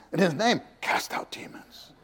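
whispered boast. Acted. Preacher mimicking believers' boast, whispered. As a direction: hush it like a secret brag.

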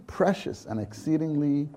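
normal talking voice

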